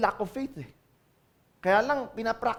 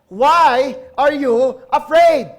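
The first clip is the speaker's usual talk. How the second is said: loud, slow, drawn-out question; Jesus calmly questioning; 6 semitones higher, 12 dB louder, much slower than usual. Acted. Jesus turning to frightened disciples, loud slow question. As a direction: stretch every word out loud and slow. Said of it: "si Jesus said to them".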